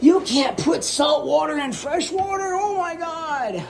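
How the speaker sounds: high, hammed-up scandalised voice; mock viewer outrage. Imagined viewer scolds the host, high mock disbelief. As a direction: exaggerate the disbelieving fan.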